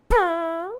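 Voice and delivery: high lip-buzzed fart; imitated fart noise. Guest sneaking one out at dinner, fart noise. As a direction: blow a raspberry for the fart. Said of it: "and it comes out all".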